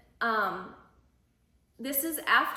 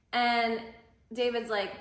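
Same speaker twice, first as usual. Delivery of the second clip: normal talking voice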